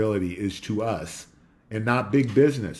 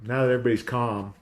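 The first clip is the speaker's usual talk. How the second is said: usual speaking voice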